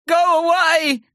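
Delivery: high, panicked plea; frightened boy. A narrator's reading. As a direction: shrink back and shout. Terry scared.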